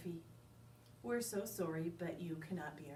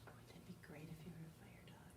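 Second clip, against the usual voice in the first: whispering; 15 dB quieter than usual. Real, not acted. Listeners whisper excitedly.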